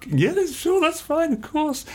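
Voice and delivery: very high, forced mock-cheerful consent; falsely agreeable. Pianist giving up the seat to a mate, falsely agreeable. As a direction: squeak out a comically reluctant yes. Pretending not to mind giving up piano.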